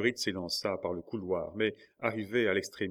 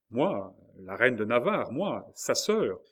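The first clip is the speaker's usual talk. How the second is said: louder, higher, slowing on sa sœur; indignant insistence; 3 semitones higher, 6 dB louder, slower than usual. A narrator's reading. Marguerite insists to the officer, indignant and loud. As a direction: insist on who she is. Queen insists at barred door.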